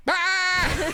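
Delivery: high, loud bleating cry; goat bleat impression. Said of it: "That's actually really good".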